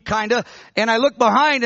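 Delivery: ordinary talk, nothing marked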